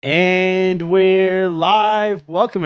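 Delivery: big, drawn-out announcer call; loud, high and held long on each word; show-opening call. Real, not acted. Host kicking off a live podcast, drawn-out announcer call.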